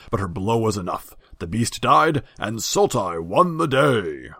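loud, high heroic declamation; grand herald telling a battle tale; herald's proclamation. Acted. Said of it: "the herald had finished his story".

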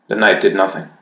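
ordinary delivery

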